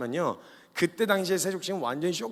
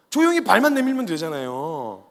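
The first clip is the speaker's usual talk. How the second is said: loud, drawn-out exasperation; exasperated; 7 dB louder, slower than usual. Real, not acted. Preacher faulting Peter for not offering his feet, exasperated. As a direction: stretch it out loudly, exasperated.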